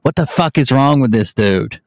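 ordinary delivery

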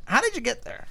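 squeaky high-pitched mock question; high silly voice. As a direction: ask it in a goofy high voice. Repeated in a high put-on voice.

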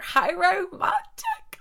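high, slow, dreamy sigh on romantic; mock swoon. Swooning to listeners about a translator romance, mock dreamy. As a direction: swoon over it theatrically.

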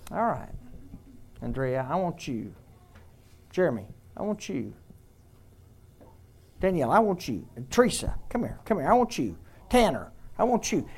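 soft, calling each name tenderly; tender voice of God. Pretend. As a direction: call each one gently, as a loving father. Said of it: "if God said".

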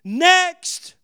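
loud, high shout of Next; full-voice shout into the open air. Retold or imitated. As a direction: bellow it to the sky. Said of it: "I shouted at the top of my voice".

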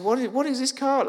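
high, mock-indignant questioning; retold outrage, stumbling on the question; mad wife. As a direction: imitate an affronted wife, pitch well up. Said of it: "and she was mad".

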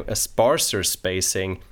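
usual speaking voice

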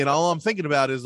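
normal talking voice

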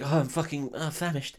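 a touch of feeble complaint; Frodo. Acted. Hungry hobbit moaning to his companions, lightly mocked. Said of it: "Frodo's like".

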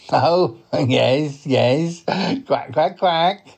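cartoon duck voice with a laugh; chuckle, then quack along; talking duck character; chuckling duck. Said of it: "he is a duck".

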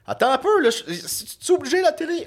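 high, loud panicked plea. Retold or imitated. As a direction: beg them to turn it off. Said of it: "je fais que".

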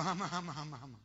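lightly imitated tongue-tied comic; Jackie Gleason. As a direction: babble as if unable to get words out. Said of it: "Jackie Gleason". (imitación leve de cómico trabado)

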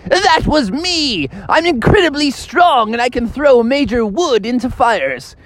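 very high, slow boastful little creature; a tiny snail bragging about its strength; proud snail voice. Acted. Snail bragging to the friends at the fire, very high and slow. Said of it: "And the snail was like".

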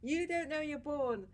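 high, loud Irish mum, laughing through; Irish mum exaggerating her hard childhood; Irish accent. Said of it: "in an Irish accent".

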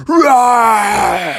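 playful bear cub roar; big roar. A narrator's reading. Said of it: "he gave out a big roar".

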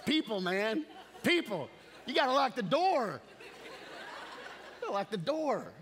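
loud, high, laughing plea; laughing exhortation. Real, not acted. Pastor laughing as he urges the congregation to lock the door. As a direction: laugh it out while pressing the point.

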